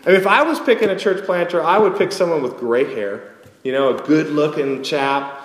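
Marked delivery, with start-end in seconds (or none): none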